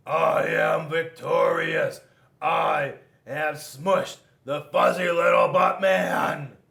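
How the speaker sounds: loud, slow triumphant shout; victory shout. A narrator's reading. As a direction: crow over the beaten foe. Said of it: "Rock said".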